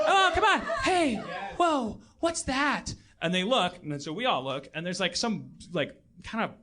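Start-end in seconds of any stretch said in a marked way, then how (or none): 0.0-3.0 high, laughing mock alarm; hammed-up actor. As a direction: ham it up like a bad ride actor. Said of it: "it's like".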